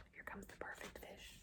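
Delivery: hushed whispered aside. Real, not acted. Teacher whispering to kids about the next fish. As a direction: whisper it like a secret to the class.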